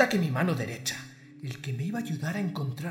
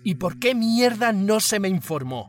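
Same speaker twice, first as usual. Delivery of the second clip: higher, louder angry demand; furious shout; 6 semitones higher, 8 dB louder than usual. Acted. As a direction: snap at the subordinate. Boss furious at the news.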